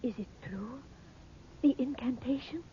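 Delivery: hushed, breathless whisper of wonder; whispering in awe; awed whisper. Acted. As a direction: barely voice it, afraid to hope.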